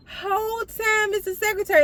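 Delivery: very high, mock-incredulous squeal; high-pitched reveal. Storyteller revealing the culprit to viewers, high-pitched comic disbelief. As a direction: repeat it way up high for comic effect.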